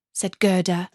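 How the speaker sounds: ordinary delivery, nothing marked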